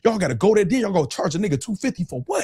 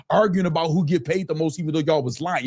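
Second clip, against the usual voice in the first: usual speaking voice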